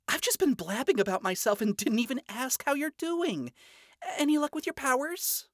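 chirpy princess voice; bright young woman. Acted. Charlie voiced in story.

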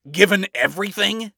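high, loud, scoffing question; snorting. A narrator's reading. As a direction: scoff it, pitched right up. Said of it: "Adam said with a snort".

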